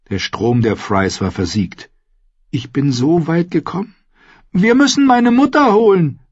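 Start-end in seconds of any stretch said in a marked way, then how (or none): 2.5-6.2 rising to a loud high cry; beg, building to a cry; desperate pleading. Acted. Arya begs Clegane to fetch her mother, desperate pleading cry.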